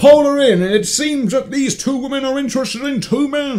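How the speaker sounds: high, dramatic host announcement, slowing at the end; hammed-up reality TV host voice; mock game-show host.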